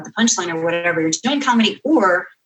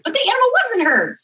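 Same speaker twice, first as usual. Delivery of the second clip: higher, indignant retort; exasperated protest. Retold or imitated. Author answers the angry editor, higher and exasperated. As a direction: protest that nothing bad happened.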